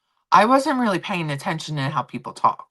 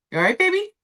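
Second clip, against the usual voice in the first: pet talk; 12 semitones higher, much faster than usual. Real, not acted. Talking sweetly to her cat.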